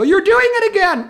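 put-on very high, loud kids' protest; children calling out. Children catching teacher singing by mistake, calling out high and loud. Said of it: "And they go".

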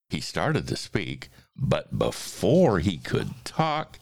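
animated rhyming storybook reading; story narration. A narrator's reading. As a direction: read the verse with big, playful swings. Reads a rhyming story aloud.

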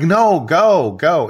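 high, loud retold cheer-on; eager urging. Photographer encouraging crying clients to keep going, eager urging.

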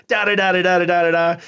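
imitating an accusatory rant in nonsense syllables; lightly aggressive mimicry of a scolding; mock aggressive chastising. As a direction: rattle off a mock telling-off. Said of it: "aggressive and accusatory in tone".

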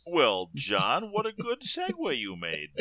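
hammed-up, high sing-song praise; swooping, put-on host pitch; mock-polished segue. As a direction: play along like a cheesy co-host. Playing along with belated segue.

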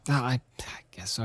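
breathy whispered concession, barely voiced; resigned whisper. Real, not acted. Admitting the podcast is boring, whispered. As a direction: let it slip out as a whisper. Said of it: "Is this getting boring?".

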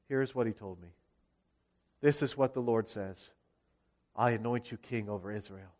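low, soft and solemn; grave, subdued revelation; hushed disclosure. Acted. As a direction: confide it quietly, dropping well down in pitch. Jehu confides the prophecy to officers.

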